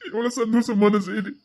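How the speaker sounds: slow, anguished lament; broken, tearful self-reproach; crying. Acted. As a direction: weep slowly over happy news. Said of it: "அழாதீங்கய்யா".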